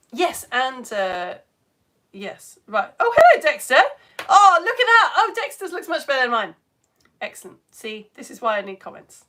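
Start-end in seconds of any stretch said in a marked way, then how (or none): 3.0-6.5 high, loud delighted greeting; excited admiration. Real, not acted. Presenter admiring a viewer's experiment, excited. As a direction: exclaim with real admiration, starting high and loud.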